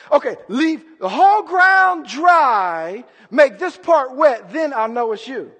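loud, drawn-out second request to God; lightly acted haggling with the Lord; Gideon. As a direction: stretch out the conditions, high and loud at first. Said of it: "You're like Gideon".

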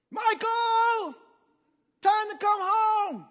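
high, loud, drawn-out holler; mother calling. Retold or imitated. Mother calling her son home from play, sing-out holler. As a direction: call a child home from far off. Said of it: "you hear a voice".

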